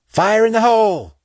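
high, loud warning shout; yell. A narrator's reading. Flame warns the squad before the charges blow, yelling. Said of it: "she yelled".

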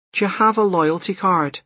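put-on high-pitched checkout girl; shop assistant. A narrator's reading. Cashier to a customer in a hurry, high routine patter. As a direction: chirp it like a cashier's routine question.

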